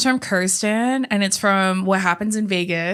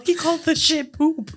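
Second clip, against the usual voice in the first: high, laughing comic imitation; imitated movie goofball; 7 semitones higher than usual. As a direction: mimic a silly character in a high voice. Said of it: "he's all".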